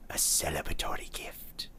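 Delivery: breathy whispered aside; hushed aside. Acted. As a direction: whisper it conspiratorially.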